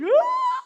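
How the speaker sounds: very high, loud scream; hammed-up shriek of panic; mock terrified scream. People freaking out at Moses' shining face, mock scream. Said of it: "the people freak out".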